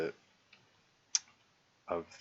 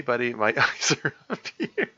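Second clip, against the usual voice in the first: laughing while reading; 3 semitones higher, 6 dB louder than usual. Real, not acted. Reading a funny in-game message.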